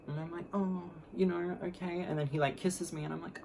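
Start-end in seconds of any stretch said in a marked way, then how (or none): 0.5-2.0 high, soft, coy murmur; playing it cute. Flirting with her date, playing it cute. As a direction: act coy in a small high voice. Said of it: "playing it cute".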